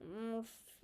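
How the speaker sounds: muffled, as if behind a mask. Real, not acted. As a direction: talk muffled through cloth. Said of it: "my muffled voice".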